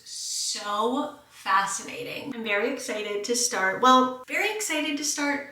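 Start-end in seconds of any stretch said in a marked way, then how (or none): 3.8-5.5 high, loud overexcited take. Shown as a demonstration. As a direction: pitch it way up, hammed-up excitement. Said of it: "I don't know if that's actually true".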